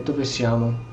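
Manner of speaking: ordinary delivery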